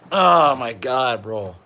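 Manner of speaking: drawn-out laughing oh my God. Real, not acted. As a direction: laugh through the words slowly. Laughing after remark.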